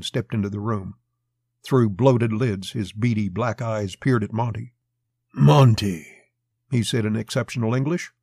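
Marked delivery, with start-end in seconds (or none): 5.3-6.1 loud, imposing summons; commanding big man. A narrator's reading. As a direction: call the name out with authority. Massive Mexican summons Monty.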